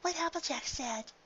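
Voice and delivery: high, soft timid voice; Fluttershy's voice. Acted. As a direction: shyly agree. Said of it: "Fluttershy added".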